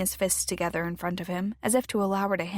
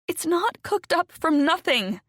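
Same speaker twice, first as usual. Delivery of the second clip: slow, higher-pitched indignant insistence; indignant protest; 9 semitones higher, 4 dB louder than usual. A narrator's reading. Wife protests to husband who calls her delusional, indignant. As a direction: push back hard, stressing the denial.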